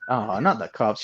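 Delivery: ordinary talk, nothing marked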